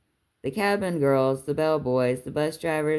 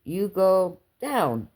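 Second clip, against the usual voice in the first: "down" stressed hard in a slow, high phrase; slow, insistent repeat, falling on "down"; emphatic; 6 semitones higher, much slower than usual. A narrator's reading. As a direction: space the words out and land firmly on "down". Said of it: "he repeated with emphasis".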